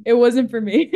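giggling through the confession; laughing mock confession. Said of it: "it did suck".

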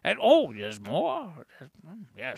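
slow put-on character voice; Baron. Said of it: "What did you think, Baron?".

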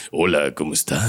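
low, deep villainous greeting. Retold or imitated. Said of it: "voz de villano".

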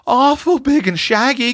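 put-on high, frightened small child's voice; timid high-pitched child; quavering little girl. A narrator's reading. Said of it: "quavered Roxy".

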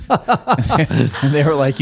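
slow, high, laughing through the words; can barely get it out for laughing. Real, not acted. Guest laughing as he retells the managers' reaction, laughing. Laughing mid retold story.

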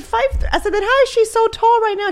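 mother questions the pediatrician, high and incredulous; shocked disbelief. Retold or imitated. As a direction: retell the shock, pitch up high.

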